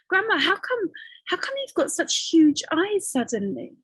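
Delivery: put-on a girl asking grandma about her eyes; curious girl.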